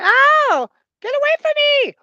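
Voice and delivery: very high, loud shriek; screaming little girl. Acted. Farmer's daughter to the simpleton, shrieking in alarm. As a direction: shriek it as a frightened child.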